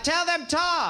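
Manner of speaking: high mock-British mum; English mother's accent. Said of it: "mother never really adopted American English".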